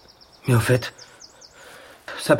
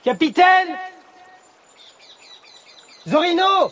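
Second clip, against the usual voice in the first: high, urgent shout into the distance; loud calling; 3 dB louder, slower than usual. Acted. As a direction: call out to someone far away. Searching louder, no answer.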